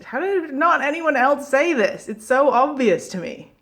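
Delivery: high, exasperated self-quote; mock incredulity. Vlogger quoting their own reaction to the obvious, incredulous.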